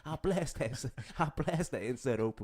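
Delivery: high-pitched American robber, breaking into laughs; hammed-up American stick-up voice. Robber threatening the betting shop clerk, mock American. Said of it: "you just stereotyped all of America".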